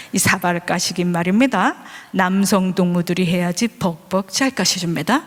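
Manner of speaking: low North Korean accent, retold; embarrassed North Korean man. North Korean father excusing his dishwashing to the teacher, embarrassed. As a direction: imitate a sheepish North Korean dad. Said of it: "그러면서 저한테 민망하니까".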